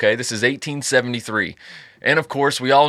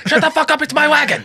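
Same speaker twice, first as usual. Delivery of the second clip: high, loud mock yell; angry yell; 4 dB louder than usual. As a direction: bark it like a cranky old man. Grumpy German man on wagon.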